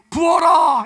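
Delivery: loud high command; commanding shout. Retold or imitated. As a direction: call it out like a divine order. God commands anointing. (크게 외치는 명령)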